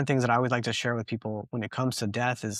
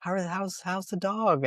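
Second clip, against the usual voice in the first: imitating a friendly woman, much higher; bright high-pitched greeting, overly friendly; friendly lady; 10 semitones higher, slower than usual. Friendly neighbor greeting grieving dog owners in high voice. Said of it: "she's very friendly".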